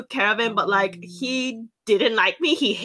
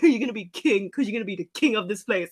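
lightly voiced dad, lower and quick; a hint of a father's proclamation, pitched down; imitated dad; 4 semitones lower, 4 dB quieter, faster than usual. Imitates dad naming the dog.

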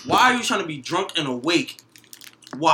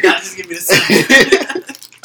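laughing demand; 3 semitones higher, 9 dB louder, slower than usual. Pretend. Mock robbery demand.

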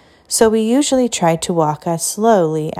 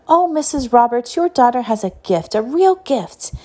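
flattering gush; 5 semitones higher than usual. A narrator's reading. Teacher flattering the mother.

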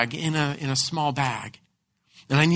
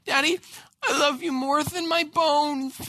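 tearful; 10 semitones higher than usual. Retold or imitated. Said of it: "she said".

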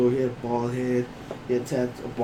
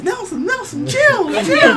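very high-pitched imitation, getting louder; panicked coworker; 7 dB louder, slower than usual. Coworker trying to stop Nelson fighting, high urgent pleading. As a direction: plead with a friend to calm down, voice way up.

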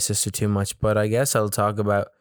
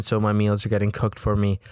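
ordinary delivery

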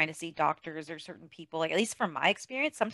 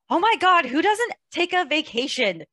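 high, loud mock disbelief, slowing down; incredulous; 9 semitones higher, 5 dB louder than usual. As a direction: exclaim it in disbelief. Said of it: "And I was like".

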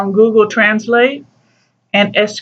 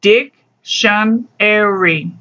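"Dictionary" drawn out very slowly, split into its four syllables DIC-TION-AR-Y; teacher spelling out the syllables of "Dictionary" for students; much slower than usual. Shown as a demonstration. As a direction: sound out each syllable of "Dictionary" slowly, one at a time. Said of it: "Esas cuatro sílabas".

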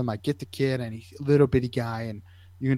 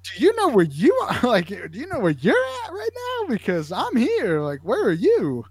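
put-on incredulous kid; 10 semitones higher than usual. Boy turning the question on the medic, incredulous high voice. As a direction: throw the question back as if asked by an idiot. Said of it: "looking at me like I'm an idiot".

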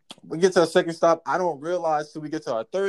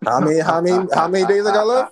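eager patient; 3 semitones higher, 8 dB louder than usual. Pretend. Mock cancer patient.

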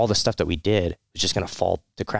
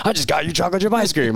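hammed-up indignant father, pitched high and loud; mock his own outrage at his son, high and loud; mock angry rant; 11 semitones higher, 4 dB louder, faster than usual. Veteran retelling how he scolded his son, in a hammed-up high indignant voice. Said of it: "And so I was just so mad".

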